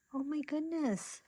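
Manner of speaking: high delighted exclamation; a high, happy oh my goodness; delighted gush. Real, not acted. Delighted by a cute item.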